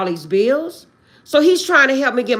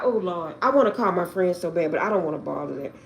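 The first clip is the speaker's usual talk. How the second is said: normal talking voice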